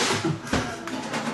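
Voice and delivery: high breathy giggle. Real, not acted. Laugh after punchline.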